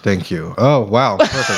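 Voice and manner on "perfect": high laughing yell of delight; laughing shout. Real, not acted. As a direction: burst out laughing on it. Laughing at the pun.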